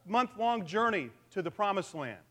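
ordinary talk

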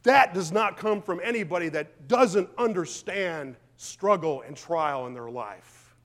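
normal talking voice

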